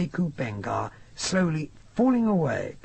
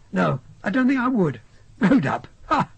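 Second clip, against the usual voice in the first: louder, mock-incredulous scoff ending in a bark of a laugh; 6 semitones higher, 6 dB louder than usual. A narrator's reading. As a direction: laugh off the idea, loud and disbelieving. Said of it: "He gave a peculiar laugh".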